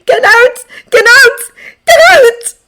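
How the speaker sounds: repeated high, full-force shrieks; hysterical, anguished screaming. A narrator's reading. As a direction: scream it harder each time. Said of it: "she screams into his chest".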